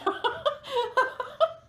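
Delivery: squeaky burst of laughter; high giggle. Real, not acted. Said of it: "I'm losing it".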